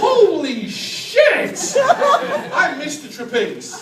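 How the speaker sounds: loud, slow, laughing yell of panic; mock panicked yell. Retelling the trapeze fall to the crowd, loud panicked yell. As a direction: shout it like you just missed the bar.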